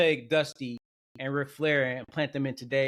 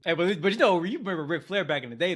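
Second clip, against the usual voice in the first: normal talking voice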